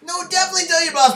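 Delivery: fast, high, eager retort; excited. Real, not acted. Joking contradiction, fast and high.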